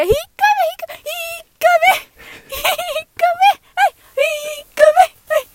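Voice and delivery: high, loud jeering chant; jeering crowd. Acted. Classmates jeering at the samurai, high and loud. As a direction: shout him off the stage.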